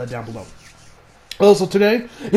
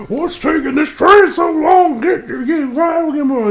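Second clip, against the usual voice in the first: impatient passengers complaining; 8 semitones higher, 5 dB louder than usual. Retold or imitated. Passengers stuck on a train, loud impatient complaining. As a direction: drag it out like a fed-up passenger. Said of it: "and everyone's like".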